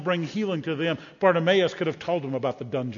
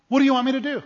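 high-pitched, incredulous question; much higher, emphatic asking; emphatic repeat; 8 semitones higher, 4 dB louder, much faster than usual. Acted.

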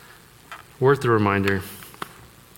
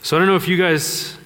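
ordinary talk, nothing marked

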